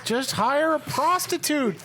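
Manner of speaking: high, slow, laughing exasperation; drawn-out, high-pitched comic outrage; exasperated mock rant. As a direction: plead incredulously with a hopeless fool. Ranting at the absent man.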